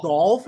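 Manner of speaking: golf with an exaggerated round O; forced standard pronunciation. Shown as a demonstration. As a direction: say "gawlf" for golf as if it's distasteful. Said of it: "can you say it normally".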